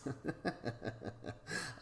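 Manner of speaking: a real breathy high chuckle; short amused giggle; laugh. Real, not acted. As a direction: laugh at your own joke. Laughing at own joke.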